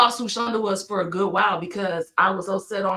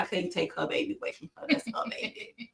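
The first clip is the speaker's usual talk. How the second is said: hushed aside; 11 dB quieter than usual. Real, not acted. Aside about not taking the baby.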